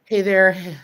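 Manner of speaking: ordinary talk, nothing marked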